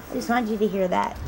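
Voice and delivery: ordinary delivery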